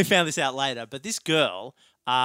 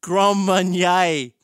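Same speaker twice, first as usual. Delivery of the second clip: drawn out and louder; slow made-up answer; 5 semitones higher, 4 dB louder, slower than usual. Retold or imitated. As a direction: stretch it out while inventing.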